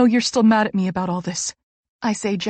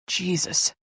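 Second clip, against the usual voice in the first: a quiet dismayed mutter; 2 semitones lower, 6 dB quieter than usual. A narrator's reading. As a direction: mutter it to yourself. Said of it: "Jake mutters".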